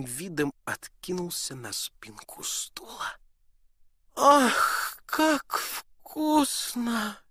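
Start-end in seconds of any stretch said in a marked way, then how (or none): 4.2-7.2 high, loud, drawn-out delighted cry; duke exclaiming. Acted. Duke over the pie, a loud delighted cry. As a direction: savour it, a greedy duke's rapture. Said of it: "воскликнул он".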